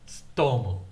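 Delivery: Tsutomu with a ts start; Japanese name. Shown as a demonstration. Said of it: "his first name was Tsutomu".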